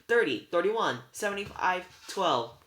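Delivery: high, loud called-out numbers; belted out. A narrator's reading. Sisters giving up the secret numbers, belted out. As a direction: belt it out like a quarterback calling a play. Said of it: "They belted it out like a quarterback".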